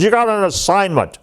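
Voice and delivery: high, mock-angry announcement; retold anger. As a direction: imitate a mad commander, pitch pushed up. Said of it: "he's really mad".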